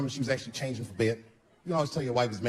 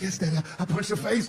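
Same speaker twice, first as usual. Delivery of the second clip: put-on higher childlike confession; small boy voice; 7 semitones higher than usual. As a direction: answer like a little boy. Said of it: "He said".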